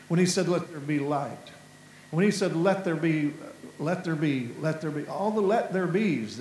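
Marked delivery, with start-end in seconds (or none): none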